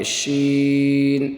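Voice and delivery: شین with a sibilant "sh" and long "ee"; slow model recitation of شین; whistling sheen. Shown as a demonstration. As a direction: stretch شین out on a level note. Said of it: "سیٹی کی آواز ہونی چاہیے".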